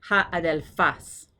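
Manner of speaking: slow "Ha adelfas", stressed on FAS; a-del-FAS, spoken slowly; accent on last syllable. Shown as a demonstration. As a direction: land hard on the end of "adelfas". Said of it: "the accent is there".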